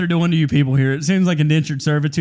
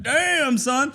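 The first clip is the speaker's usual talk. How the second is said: mock impressed bro; 8 semitones higher than usual. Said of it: "I was like".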